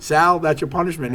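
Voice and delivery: spring the news on Sal gleefully; mock announcer. Crew announces again.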